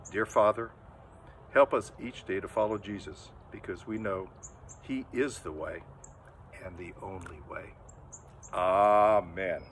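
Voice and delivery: put-on a low, hushed prayer for the children. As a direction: pray gently for the children. Leads a prayer.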